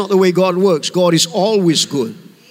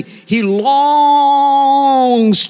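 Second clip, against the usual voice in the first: LONGS held very long and louder; emphatic longing; 5 semitones higher, 5 dB louder than usual. Real, not acted. Preacher on God's wish to be gracious, LONGS drawn out. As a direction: stretch out "longs" in one sustained note.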